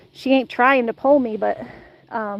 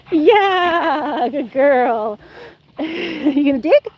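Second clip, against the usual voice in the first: ordinary delivery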